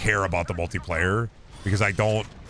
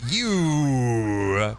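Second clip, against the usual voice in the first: imitating wife. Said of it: "she went".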